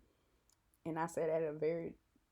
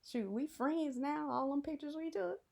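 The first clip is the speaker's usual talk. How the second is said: mock bravado; 8 semitones higher than usual. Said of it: "I was still kinda hesitant".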